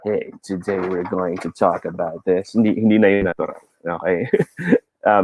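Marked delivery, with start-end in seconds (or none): 0.0-2.5 low, soft, overdone seductive voice; mock-sultry, pitched down and hushed; enticing bedroom voice. Shown as a demonstration. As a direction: croon it low like a bedroom-voice parody. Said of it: "enticing na yung dating ng boses".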